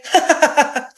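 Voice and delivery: short teasing snicker; mocking laugh. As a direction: laugh at the heartbroken girl. Class laughs at Cheska.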